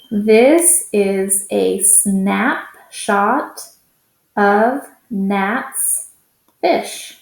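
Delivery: words drawn out slowly for the class; slow read-along. Shown as a demonstration. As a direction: read slowly so children can follow. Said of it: "let's read it again together".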